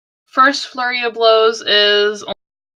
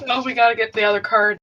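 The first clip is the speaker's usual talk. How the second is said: ordinary delivery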